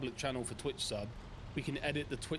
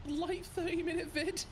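mock disbelief; 12 semitones higher, slower than usual. Real, not acted. Mocking teammate's claim.

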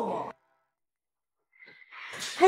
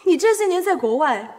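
ordinary talk

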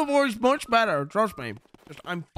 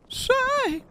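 put-on much higher, sharp old man's "Say!"; Herbert's call. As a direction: call out like a creepy old neighbor. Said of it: "Oh, it's come back".

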